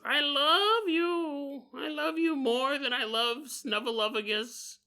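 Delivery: high, slow, drawn-out declaration of love; Big Bird. Acted. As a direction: croon it in a high creepy bird voice. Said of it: "And he goes".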